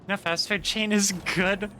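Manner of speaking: normal talking voice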